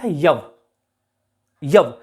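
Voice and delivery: यव said slowly as ya-va; यव pronounced. Shown as a demonstration. Teacher shows the old word यव. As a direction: stress both syllables of यव. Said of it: "इसका सही उच्चारण क्या था".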